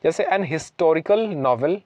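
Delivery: ordinary talk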